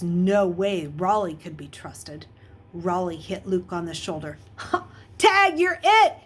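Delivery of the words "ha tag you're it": much higher, loud playful holler. A narrator's reading. Bully hitting Luke and running, hollering. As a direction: shout it as you run off. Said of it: "he hollered".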